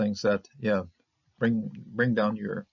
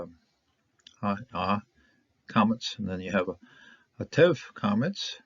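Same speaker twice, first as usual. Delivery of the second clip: ordinary talk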